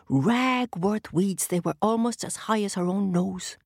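plain reading voice

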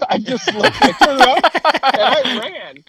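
laughing high through the words; laughing while telling how he fled. Real, not acted. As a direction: tell it while cracking up.

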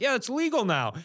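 imitating a shrugging crowd; mock casual approval. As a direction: toss it off like it's obvious. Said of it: "everybody's like".

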